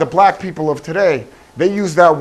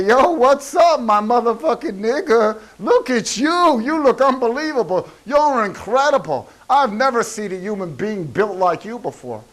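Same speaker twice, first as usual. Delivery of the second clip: much higher, excited street greeting; hyped-up greeting; 7 semitones higher than usual. Pretend. Greeting a friend on the street, high and hyped. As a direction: gush over a buddy in a high hyped voice. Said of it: "go up to some human being and say".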